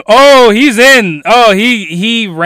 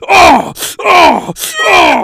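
hammed-up high moans; 9 semitones higher, much slower than usual. Villain moaning in a dub.

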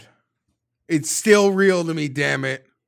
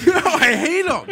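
higher, laughing through the words; 5 dB louder than usual. Real, not acted. Fan laughing at his own tirade, laughing. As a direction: laugh it off while still meaning it.

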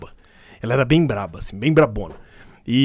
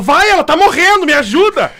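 much higher, mock-urgent teacher pushing; hammed-up panic from a stern teacher; 13 semitones higher, 4 dB louder than usual. (professora pressionando o aluno, em pânico fingido)

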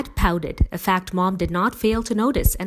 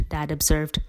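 usual speaking voice

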